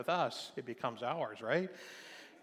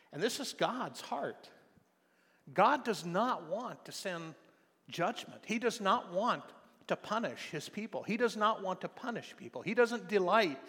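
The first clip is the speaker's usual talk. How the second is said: emphatic preaching; 6 semitones higher than usual. Acted. Preacher emphasizing God's heart.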